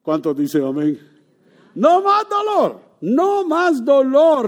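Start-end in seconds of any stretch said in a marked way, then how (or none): 1.7-4.5 much higher, slow and loud; slow climactic proclamation. Real, not acted. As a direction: ring it out slowly.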